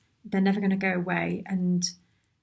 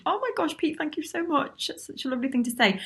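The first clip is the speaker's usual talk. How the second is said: much higher, thrilled and touched; delighted gush; 7 semitones higher than usual. Real, not acted. Thanking Pete for his kind comment, real delighted gush. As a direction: squeal your thanks, genuinely overwhelmed.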